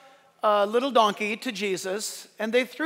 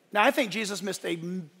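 ordinary delivery

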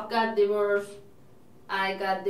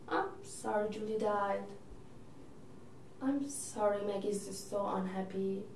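softly sorry; 3 semitones lower, 12 dB quieter than usual. Acted. Sister consoling brother.

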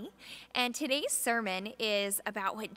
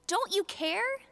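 higher, pleading and hurt; desperate plea; 10 semitones higher, 4 dB louder than usual. Acted. The frightened disciples asking Jesus, desperate plea. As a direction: accuse him in fear. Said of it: "they shrieked".

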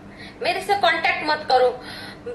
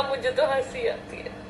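laughing whisper; 3 semitones lower, 5 dB quieter than usual. Retold or imitated. Told to cry, she whispers.

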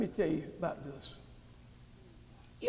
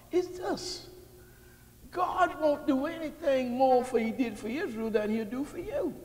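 climbing louder and higher, driving the point home; full-force preaching; 8 semitones higher, 8 dB louder than usual. Acted. Preacher to his congregation, rising to a loud fervent peak. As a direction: preach it out big to the whole church.

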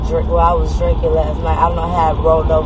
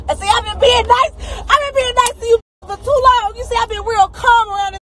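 enraged, near tears; 14 semitones higher than usual. Real, not acted. Shrieking high at a commenter, almost crying with rage. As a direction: scream it like your patience just snapped.

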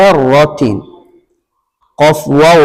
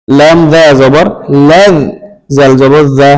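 ordinary talk, nothing marked

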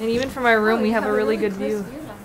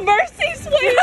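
high laughing squeal; delighted squeal; 3 dB louder, slower than usual. Real, not acted. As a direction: squeal it out in glee. Squealing at umbrella mishap.

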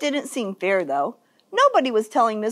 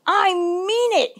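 high-pitched, insistent warning; threatening; 8 semitones higher than usual. A narrator's reading. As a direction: stand your ground against the storm. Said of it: "he threatened".

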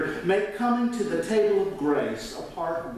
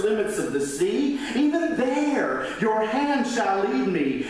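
raised, preached scripture reading; 5 semitones higher than usual. Acted. Pastor reading the Psalm aloud to his congregation, preaching it. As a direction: read the psalm out as if proclaiming it to the church.

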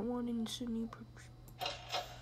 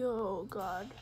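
ordinary talk, nothing marked

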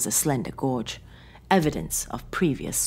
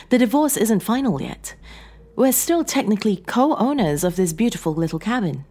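high, louder voice of a needling wife; Sarah, composed estranged wife, needling; 8 semitones higher, 4 dB louder than usual. Acted. Estranged wife reminding John of the divorce, needling.

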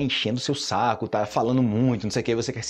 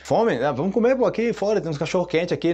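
higher, eager offer; eager friend; 5 semitones higher than usual. Retold or imitated. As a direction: jump at the chance to eat. (amigo animado chamando pra comer)

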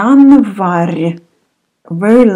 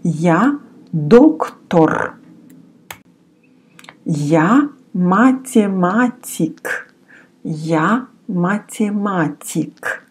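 Я доктор, slow and deliberate; teacher's slow model sentence; slow model reading; 3 dB quieter, slower than usual. Shown as a demonstration. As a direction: say Я доктор slowly for learners. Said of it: "form a little sentence".

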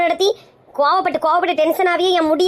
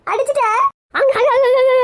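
frantic yelling; 6 semitones higher than usual. Acted. Aunt yells in alarm as the fight erupts. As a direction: yell in alarm as the fight starts.

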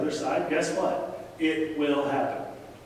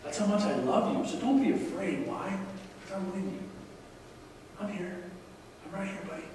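soft, higher-pitched comforting voice; tender reassurance; 4 semitones higher, 5 dB quieter than usual. Acted. Preacher voices God comforting a frightened believer, soft and tender. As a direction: hush it like soothing a small child.

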